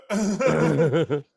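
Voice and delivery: loud, high "ugh" played for laughs; mock mortified groan. DJ retelling hearing Janet was downstairs, loud mock groan. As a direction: let out a sudden loud groan of shock. Acts out own reaction to the news.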